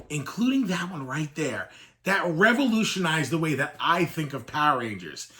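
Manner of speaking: ordinary delivery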